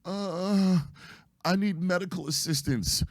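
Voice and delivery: high, drawn-out whine of feigned suffering; mock theatrics. Injured young woman pleading from the tracks, mock theatrical. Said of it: "puts the theatrics on".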